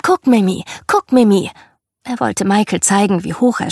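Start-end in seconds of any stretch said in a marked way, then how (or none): 0.0-1.5 put-on little boy calling for attention, louder. A narrator's reading. Little brother on the swing wants Michael to watch, calling out. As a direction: call out as if from a swing. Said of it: "Dann rief er".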